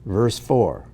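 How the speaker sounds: ordinary delivery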